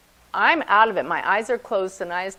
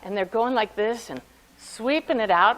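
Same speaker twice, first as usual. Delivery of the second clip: normal talking voice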